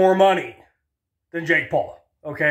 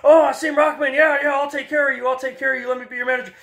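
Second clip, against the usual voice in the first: much higher, oily sweet-talking promoter; slick promoter; 7 semitones higher, faster than usual. Retold or imitated. Don King sweet-talking the new champ, high oily promoter voice. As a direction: schmooze the new champ to sign him. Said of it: "Don King immediately got in there with him".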